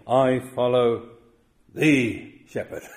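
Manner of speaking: slow, deliberate words, each one weighed; slow emphatic. Shown as a demonstration. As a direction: spell out I follow the shepherd slowly. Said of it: "David is saying".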